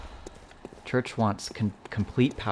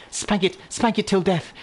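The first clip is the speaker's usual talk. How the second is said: higher mock-posh British accent; 11 semitones higher, 6 dB louder than usual. Shown as a demonstration. Gamer reads the item line to chat in British accent. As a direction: put on a British accent for the gag. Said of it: "I'll just read that in a British voice".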